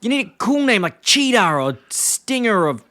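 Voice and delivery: high-pitched imitation of a disgusted boss; mock scorn. Herb retold scorning the car name.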